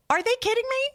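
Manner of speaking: high, disbelieving outcry; can't believe it, voice shooting up; incredulous. Real, not acted. Outraged at the drug name.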